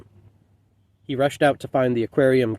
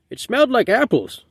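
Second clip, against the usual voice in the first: much higher, sudden blurted remark; sudden old man; 11 semitones higher, 4 dB louder than usual. A narrator's reading. Old man to no one in particular, blurting suddenly. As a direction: pipe up suddenly in a high voice. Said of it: "an old man ... said suddenly".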